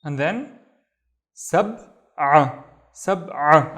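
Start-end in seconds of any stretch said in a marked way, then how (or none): 1.3-3.8 "سبعة" loud, with a squeezed throat ain; "سبعة" broken before a strong pharyngeal ع; vomiting sound. Shown as a demonstration. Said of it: "we have this vomiting sound".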